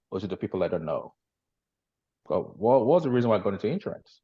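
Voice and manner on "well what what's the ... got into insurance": normal talking voice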